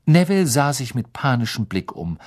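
ordinary delivery, nothing marked

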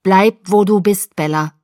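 loud, firm order dropping on the name; forceful command. A narrator's reading. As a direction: order her to stay put. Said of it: "befahl Sam".

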